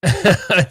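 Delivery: sudden high laugh; burst of laughter. Real, not acted. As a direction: laugh out loud, surprised. Laughing at GameStop comment.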